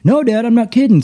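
put-on high, urgent child's voice; son insisting. Son insists to his dad there's a stranger, urgent child voice. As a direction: imitate a kid pleading to be believed.

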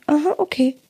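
put-on toddler voice. Voicing her toddler.

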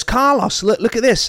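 high-pitched, acted call for attention; eager cousin. Voicing cousin urging Carlos to look.

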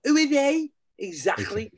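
high-pitched Scouse impression, slowed at first; Scouse accent. Fan mimicking the milk advert, in a Scouse accent. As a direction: put on an exaggerated, high Scouse voice. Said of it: "it's a Scouse accent".

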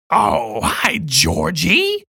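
creepy clown croon, very slow; Tim Curry's Pennywise. Retold or imitated. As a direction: stretch it out sweetly like a sinister clown. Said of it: "he's just got this".

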